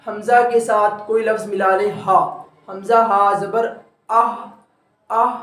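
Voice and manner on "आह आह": आह breathed out from the throat, slowly; showing the articulation point; throat sound demo. Shown as a demonstration. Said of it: "ये आह जो आवाज़ है".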